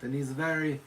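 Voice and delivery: lightly put-on French accent; French-accented character, groping for words. Shown as a demonstration. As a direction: slide into a playful French accent. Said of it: "he sometimes talks with a French accent".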